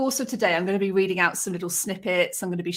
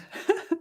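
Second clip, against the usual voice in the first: high, breathy release of a laugh; choked-up little laugh; tearful laugh; 13 semitones higher, 4 dB quieter than usual. Real, not acted. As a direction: let the emotion out in a laugh. Laugh after reading son's poem.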